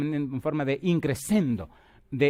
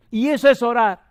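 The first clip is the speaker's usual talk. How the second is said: "Y eso es orar" shouted higher and louder, stress on "es"; booming refrain; 10 semitones higher, 9 dB louder than usual. Acted. Preacher driving home his point to the congregation, loud.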